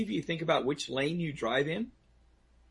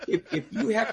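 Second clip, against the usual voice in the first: laughing; 8 semitones higher than usual. Real, not acted. Laughing after his own mailbox joke.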